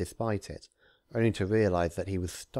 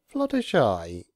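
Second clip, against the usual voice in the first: louder, higher sugary welcome; warm greeting; 4 semitones higher, 7 dB louder, slower than usual. Acted. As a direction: greet her sweetly. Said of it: "Discord greeted warmly".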